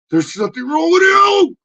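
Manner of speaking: shrill high puppet yelling it back; high puppet shout. Acted.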